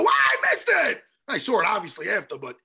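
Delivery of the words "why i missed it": higher, louder cry of disbelief; mock frustrated shout; retold shout of dismay. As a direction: yell it like you just missed the big moment. Retelling his own reaction to missing the kick.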